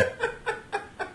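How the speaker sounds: airy high-pitched laugh; high breathy laugh. Real, not acted. Laughs about the insane translator.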